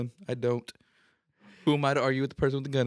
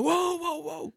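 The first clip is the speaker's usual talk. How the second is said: alarmed whoa; much slower than usual. Retold or imitated. Retold alarm at the gun.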